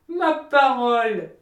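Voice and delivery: slow, loud outburst of reproach; the miller scolding Hans, loud and slow; loud exclamation. Acted. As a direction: burst out, scandalised.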